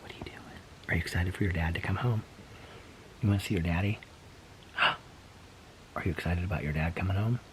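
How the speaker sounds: whispering to pet. Real, not acted. Whispering to the pet about dad coming home. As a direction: whisper close and gentle to the pet.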